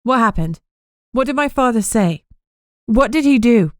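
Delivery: frightened, pressing for the news; anxious questions. Acted. Amber fears bad news.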